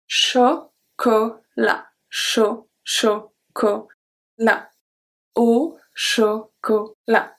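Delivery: chocolat very slowly, syllable by syllable; slow model word. Shown as a demonstration. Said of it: "Do you hear the difference?".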